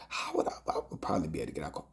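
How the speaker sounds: dropping to a near whisper; hushed aside. Real, not acted. Telling of a dream, he drops to a whisper. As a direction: murmur it half to yourself.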